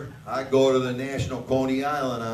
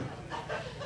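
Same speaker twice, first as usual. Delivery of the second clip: throat clear; 13 dB quieter than usual. Real, not acted. Clears throat before resuming.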